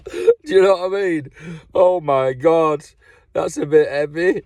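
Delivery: high, cracked-up laughing delivery; helpless laughter breaking into speech. Real, not acted. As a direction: laugh through the words, drawing them out. Cracks up at own anecdote.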